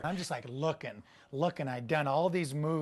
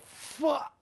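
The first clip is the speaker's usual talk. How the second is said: panicked curse; 11 semitones higher than usual. Retold or imitated. Skydiver yells when his chute opens backwards. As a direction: blurt it as the chute fails. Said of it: "I barely had time to go".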